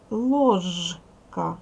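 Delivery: Ложка split as лож, ка, with a long voiced ж; syllable by syllable. Shown as a demonstration. Teacher dividing a word for pupils, Ложка in two syllables with voiced ж. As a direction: break Ложка after a loud, buzzing ж.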